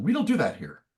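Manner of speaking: high, disapproving church lady; tut-tut like a scandalized parishioner; prim church lady. Retold or imitated. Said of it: "a lady in church going".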